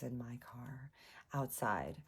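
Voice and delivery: low storytelling whisper; dread-filled whisper. Retold or imitated. Woman spots his car outside, hushed dread. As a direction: let it sink into a whisper.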